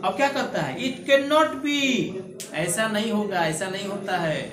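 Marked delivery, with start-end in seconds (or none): 0.8-1.8 slow, raised reading of the verse line; poem recitation. Acted. Teacher reciting the poem line before explaining it, slow and lifted. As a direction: draw out "It cannot be" like verse.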